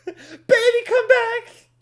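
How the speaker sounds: hammed-up lovesick pleading; begging ex-lover. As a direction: beg Minecraft like a lover who left. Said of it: "I'm like".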